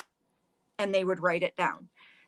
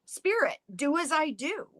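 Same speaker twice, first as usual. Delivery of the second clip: mock yell; 7 semitones higher than usual. Youngest sister calling to the spirit, high mock yell. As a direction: call out high to an unseen spirit. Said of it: "who yelled out".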